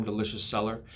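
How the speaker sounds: ordinary delivery, nothing marked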